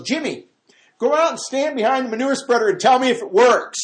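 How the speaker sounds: high, loud hollered order; father-in-law calling. Acted. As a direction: holler instructions across a farmyard. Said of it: "He takes him out back".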